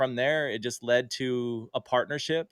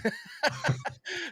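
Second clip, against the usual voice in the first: high laugh. Real, not acted. Laughing at the surprise invite.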